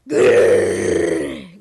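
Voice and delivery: deep rumbling growl; wolf growl. A narrator's reading. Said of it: "growled the wolf".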